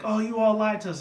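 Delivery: high-pitched pony outrage; mock rage. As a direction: act out an indignant accusation. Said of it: "all the ponies go into in a rage".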